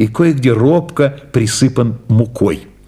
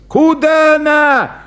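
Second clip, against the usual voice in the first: much higher, slow shout; hammed-up panicked shout; shouting clown; 13 semitones higher, slower than usual. A narrator's reading. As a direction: yell after the runaway dog. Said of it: "закричал клоун".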